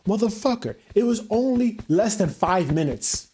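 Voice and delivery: furious, rising rant; angry outburst. Real, not acted. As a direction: spit it out in disbelief. Angry rant about staff.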